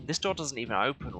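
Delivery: normal talking voice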